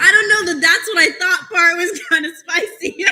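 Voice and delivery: laughing while talking, pitch up; can barely get the words out for laughing; real amused laughter through the words. Real, not acted. Chair cracking up at the jab.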